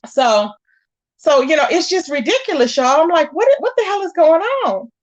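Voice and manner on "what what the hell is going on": higher-pitched, baffled outburst; exasperated disbelief. Retold or imitated. Vlogger tells viewers her reaction to the ridiculous drama, exasperated disbelief. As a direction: act out being totally baffled.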